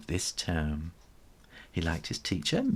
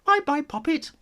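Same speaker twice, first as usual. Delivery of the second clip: very high, sing-song farewell; doting mum; 4 dB louder than usual. A narrator's reading. Mum cooing goodbye at the school gate, high. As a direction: coo goodbye to her baby.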